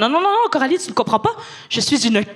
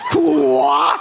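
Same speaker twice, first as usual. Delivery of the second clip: shocked outcry. Retold or imitated. Shocked at friend's answer.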